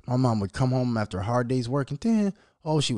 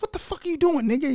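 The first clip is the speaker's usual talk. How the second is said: high-pitched startled demand; mock alarm, pitched way up; acted shock. Father pulling the blanket off his son, acted shocked outburst.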